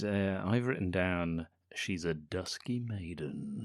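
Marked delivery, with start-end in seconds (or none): 1.8-3.6 mock-sultry description. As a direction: savour each word quietly, like a romance novel. Reading out own written description.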